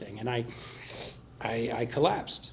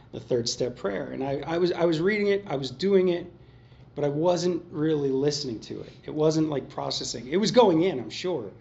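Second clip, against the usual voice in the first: ordinary delivery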